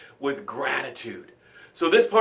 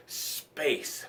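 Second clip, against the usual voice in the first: whispered; 14 dB quieter than usual. Shown as a demonstration. Host leading the breath, whispering the word. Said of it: "Let's just say it".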